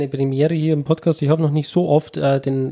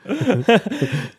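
short amused laugh; 7 semitones higher than usual. Real, not acted. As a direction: laugh at the joke. Laughs at host's joke.

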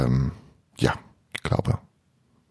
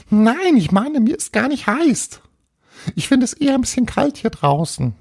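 very high, chattering tiny ghost; little castle ghost. Acted. Little ghost explains a misunderstanding to Paul, high earnest voice. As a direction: chirp it as a small, earnest ghost.

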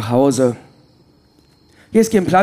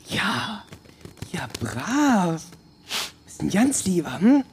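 softer, hushed praise for the bird; tender praise; 7 dB quieter, slower than usual. Acted. Prisoner praising the bird in his hand, hushed and tender. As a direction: murmur to it like a pet in your hand.

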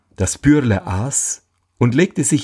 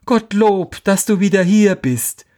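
much higher, sugary wife greeting her husband; the wife gushes at her husband to hide her guilt; feigned relief; 10 semitones higher than usual. A narrator's reading. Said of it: "machte sie dem Mann auf und sprach". (hoch gespielte, übertrieben erleichterte Begrüßung)

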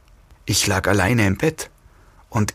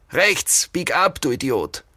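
higher-pitched irritated order; snappy quarrel; 5 semitones higher than usual. A narrator's reading. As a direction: snap at the idiot driver. Kidnappers quarrel in car.

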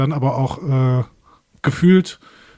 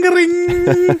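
phone ring imitation; 5 dB louder than usual.

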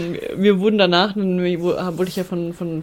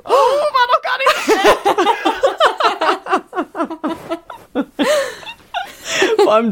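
laughing; 4 dB louder than usual. Real, not acted. Burst of laughter at near match.

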